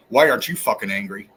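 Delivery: why aren't you fucking angry, low and rushed; repeated challenge. Real, not acted. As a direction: fire the question off low and quick. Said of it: "once again".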